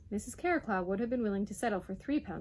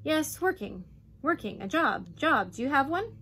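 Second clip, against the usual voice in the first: loud, higher, impatient demand; fuming; 6 semitones higher, 6 dB louder than usual. A narrator's reading. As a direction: fume at his slowness to understand. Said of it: "the Duke fumed".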